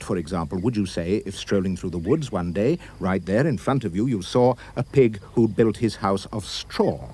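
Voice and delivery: ordinary delivery, nothing marked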